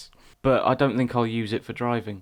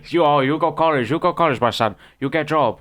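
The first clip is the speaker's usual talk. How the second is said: louder, higher mock Chinese accent; 4 semitones higher, 4 dB louder than usual. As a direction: insist like the shop lady in a Chinese accent. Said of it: "one day she just said".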